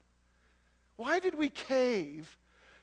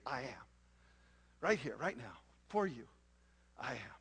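much lower and softer, close and tender; intimate God voice; 11 semitones lower, 8 dB quieter than usual. Acted. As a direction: speak as God, right beside the listener. God speaking intimately to believer.

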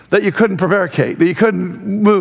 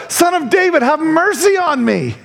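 more insistent mock shout; 8 semitones higher than usual. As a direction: imitate a desperate call, pitched well up. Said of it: "he only shouted louder".

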